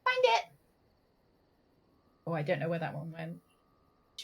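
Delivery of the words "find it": high praising pet talk; dog voice. Real, not acted. Praising her dog for finding the snack, high dog voice. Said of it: "I've got a different voice for Suzu".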